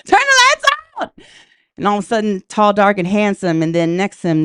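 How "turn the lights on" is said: high shriek, a little louder still; panicked shriek. Retold or imitated. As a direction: scream for the lights, higher and louder. Panicked shout repeated.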